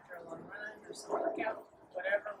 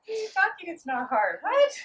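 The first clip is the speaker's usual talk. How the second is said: mock outrage; 10 semitones higher, 15 dB louder than usual. Real, not acted. Teasing partner who claims exhaustion.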